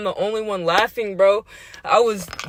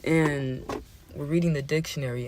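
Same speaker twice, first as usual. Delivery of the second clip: usual speaking voice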